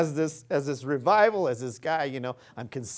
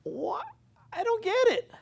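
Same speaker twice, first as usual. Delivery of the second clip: much higher, mock-incredulous squeak; baffled disbelief. The believer hearing God's love, squeaking in baffled disbelief. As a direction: play a listener who can't believe he's loved. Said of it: "And you're like".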